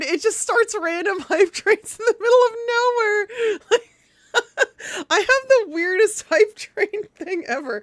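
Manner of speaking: high-pitched, laughing through the words. Real, not acted. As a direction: giggle helplessly as you talk. Hype train surprises her.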